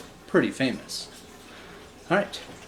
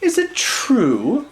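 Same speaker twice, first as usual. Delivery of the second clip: coaxing; 11 semitones higher, 3 dB louder, much slower than usual. Acted. Princess coaxing the dragon.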